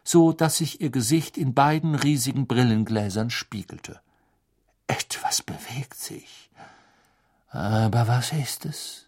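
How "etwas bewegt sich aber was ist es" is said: slow mysterious whisper. Acted. Trelawney gazing into ball.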